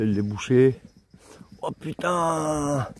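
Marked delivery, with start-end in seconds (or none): 1.6-2.9 slow, drawn-out startled curse; real surprise. Real, not acted. Walker reacting to the steep path ahead, startled and drawn out. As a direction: stretch the curse out as the slope appears.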